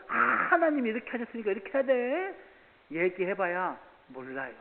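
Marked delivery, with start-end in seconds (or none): 0.1-2.3 preachy lecture. Retold or imitated. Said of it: "그 사람들 앉혀놓고".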